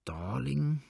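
searching the memory, quietly; musing. A narrator's reading. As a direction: try the name as a puzzled question. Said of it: "sagte er sinnend".

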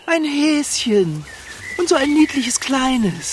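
high, slow coo at a bunny; delighted cooing. Acted. Cat spotting a bunny, cooing high and slow with delight. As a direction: coo over something tiny and cute.

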